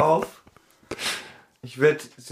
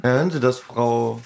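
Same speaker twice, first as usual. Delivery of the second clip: ordinary delivery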